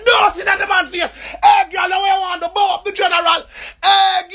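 high, loud yell; shouting protest. Retold or imitated. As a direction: shout to stop them. Shouting at officers.

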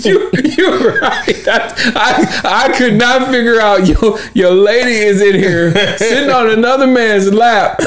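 high, wheezing laughter into the words; laughing. Real, not acted. Host laughing at his cohost's beer joke, giggling.

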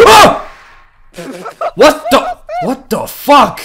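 loud high shriek of fright, then cursing; startled scream. Real, not acted. Player shrieking at a sudden scare, then cursing loudly.

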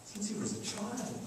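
very soft, subdued answer; hushed, understated grief; sorrowful father. Retold or imitated. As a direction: quietly imitate a worn-down parent. Anguished father answers Jesus.

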